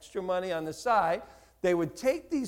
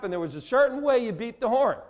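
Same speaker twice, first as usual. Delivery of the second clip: usual speaking voice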